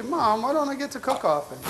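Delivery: put-on high, pleading kid asking mom; mock child whine. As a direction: lightly voice a kid begging her mom. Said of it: "Just say".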